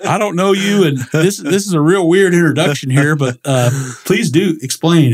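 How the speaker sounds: mock baffled politeness to a stranger; incredulous. Telling the strange neighbor to explain, amused disbelief. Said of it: "I was like".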